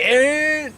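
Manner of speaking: high, loud engine whine; imitated engine revving. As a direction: whine like a car flooring it. Imitating the accelerating car.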